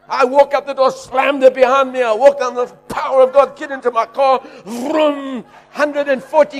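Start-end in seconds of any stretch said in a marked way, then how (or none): none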